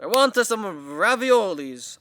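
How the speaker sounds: high, loud, slow cartoon Italian accent; exaggerated Italian accent; mock Italian accent. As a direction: ham up the Italian accent, stretching the words. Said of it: "say it with that accent".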